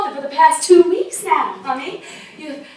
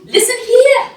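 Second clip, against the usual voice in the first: stern call; 9 semitones higher, 7 dB louder, slower than usual. Acted. Scolding the sick woman.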